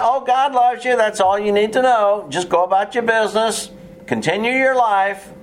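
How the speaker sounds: high, sugary mock-soothing preacher; sarcastic singsong brush-off. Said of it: "the little bit of sarcasm in my voice".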